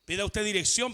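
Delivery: ordinary talk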